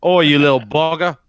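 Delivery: mock Aussie threat; bad Australian accent. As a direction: poke fun with a fake Australian. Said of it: "what he thought was an Australian accent".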